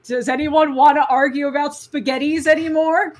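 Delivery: loud mock challenge; Saber. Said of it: "Saber's just like".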